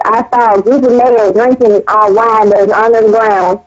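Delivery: normal talking voice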